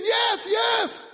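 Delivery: high, frantic yes; screamed reply. Acted. Man on the branch answering God, high frantic cry. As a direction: cry out eagerly to be saved. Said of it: "and he screamed".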